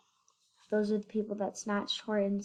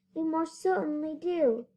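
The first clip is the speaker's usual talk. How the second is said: small voice pitched much higher; a speck-sized mayor calling up; little Who voice, high and light; tiny mayor voice; 7 semitones higher than usual. A narrator's reading. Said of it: "piped the voice".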